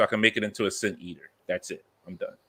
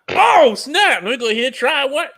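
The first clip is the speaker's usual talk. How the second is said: excited; 6 dB louder than usual. Retold or imitated. Retelling his own reaction to restock.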